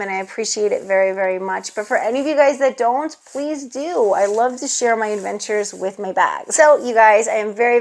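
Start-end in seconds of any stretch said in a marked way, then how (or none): none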